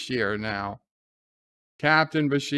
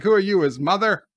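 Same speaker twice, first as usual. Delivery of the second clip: quick, high, loud retort; mock indignant comeback; 6 semitones higher, 5 dB louder, faster than usual. [name] answers Jennifer's objection, mock indignant. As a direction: toss it back fast and raised.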